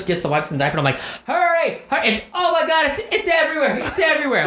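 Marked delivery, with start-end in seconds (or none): 1.3-4.5 mock freak-out over the mess; exaggerated panic. Panicking at wife to hurry with wipes, hammed-up. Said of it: "I'm being a little bit on the exaggerate".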